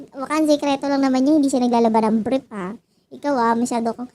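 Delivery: usual speaking voice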